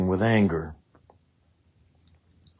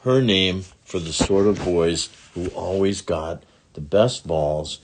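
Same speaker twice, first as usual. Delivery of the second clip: usual speaking voice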